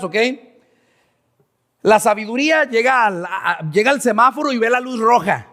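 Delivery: normal talking voice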